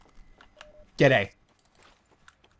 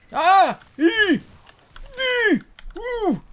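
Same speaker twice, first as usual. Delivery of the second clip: short, very high squeals; high yelps; much slower than usual. Real, not acted. Gamer in danger of dying, high yelps. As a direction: squeal in quick separate bursts.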